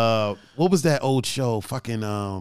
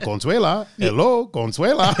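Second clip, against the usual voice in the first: lightly imitated Univision actor calling out; lilting, raised-pitch mock Spanish greeting; telenovela greeting; 6 semitones higher, slower than usual. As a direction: sing out the name like a telenovela man. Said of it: "he would talk to her like that".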